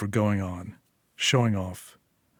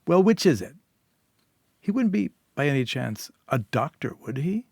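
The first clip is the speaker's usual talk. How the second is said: suspicious needling; 4 semitones higher than usual. Acted. Sil interrogates Julia.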